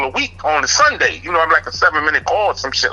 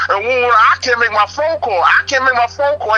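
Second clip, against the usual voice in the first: usual speaking voice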